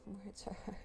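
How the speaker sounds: soft whispered aside. Real, not acted. Laughing at her own tangled words, in a whisper. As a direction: mutter it to yourself in a whisper. Self-mocking aside, hushed.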